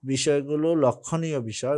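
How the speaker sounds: normal talking voice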